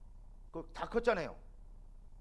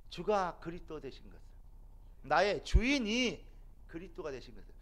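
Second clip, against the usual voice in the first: usual speaking voice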